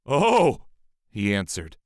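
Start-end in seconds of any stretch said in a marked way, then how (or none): 0.1-0.7 a loud startled oh; a caught thief gasping in fear; frightened cry. A narrator's reading. Man caught by the sorceress, crying out in fear.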